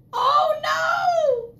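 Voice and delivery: high cry of oh no; mock alarm, up high; mock dismay. Last button lost.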